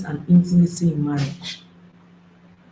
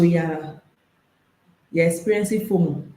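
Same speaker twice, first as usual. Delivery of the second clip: normal talking voice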